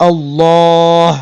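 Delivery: আল্লাহ drawn out slowly, the final h breathed clearly; slow recitation. Shown as a demonstration. Teacher demonstrating আল্লাহ slowly for students. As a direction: hold আল্লাহ long with a clear h at the end. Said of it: "হা সাকিন হবে".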